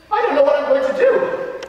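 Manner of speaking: high, anxious schoolboy lament; worried outburst. Acted. Frankie dreads his first confession, high and worried. Boy frets about confession.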